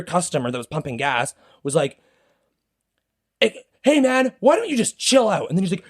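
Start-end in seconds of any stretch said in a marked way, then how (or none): 3.4-5.5 much higher, indignant acted voice; intervening bystander. Customer pumping gas to the ranting driver, high indignant intervention. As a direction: step in to call someone off.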